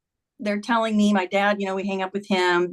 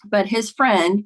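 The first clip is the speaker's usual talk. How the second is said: normal talking voice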